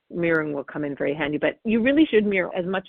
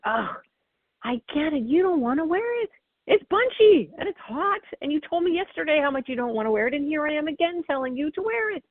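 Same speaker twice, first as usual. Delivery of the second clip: sincere mirroring; 9 semitones higher than usual. Shown as a demonstration. Parent mirroring child's raincoat complaint, sincere and sympathetic. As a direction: really feel it with the child, no lecture. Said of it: "It's just like".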